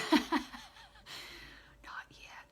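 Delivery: breathy laughing whisper, through tears. Real, not acted. Medium holding back tears, whispers through a laugh. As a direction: laugh it out under your breath, teary.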